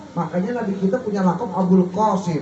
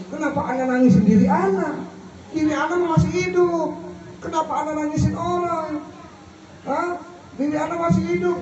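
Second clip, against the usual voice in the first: put-on puzzled young man; 6 semitones higher, 3 dB quieter than usual. Young man questions the scholar.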